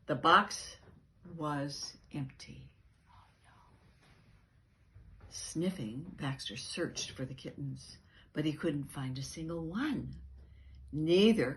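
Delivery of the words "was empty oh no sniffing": ordinary delivery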